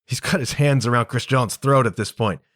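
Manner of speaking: ordinary delivery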